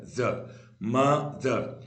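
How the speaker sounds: मदर drawn out very slowly, broken at the vowel; very slow, split मदर; slow syllable demo. Shown as a demonstration. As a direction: stretch each part of मदर. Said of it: "एम के बाद ओ आ रहा है तो म".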